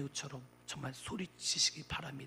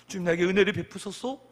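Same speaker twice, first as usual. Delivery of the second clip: loud modelled plea; demonstrated shouted appeal, moderately strong; crying out in prayer; 3 semitones higher, 9 dB louder than usual. Shown as a demonstration. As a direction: swell into a raised-voice petition. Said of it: "우리는 주님께 정말 외쳐야 됩니다".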